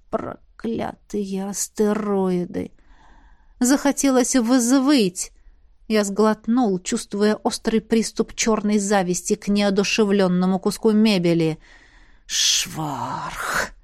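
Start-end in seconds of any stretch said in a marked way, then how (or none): 0.1-2.7 slow, drawn-out softer swearing; muttered curse. A narrator's reading. Narrator cursing at the sight of sleeping Celesta, slow muttered curse. 12.2-13.7 low, drawn-out swear word; muttered curse. A narrator's reading. Envious narrator swearing to himself, low muttered curse.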